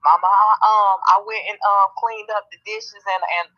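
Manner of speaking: butter mama up with chores; sweet-talking kid. Retold or imitated. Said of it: "Try to be real nice".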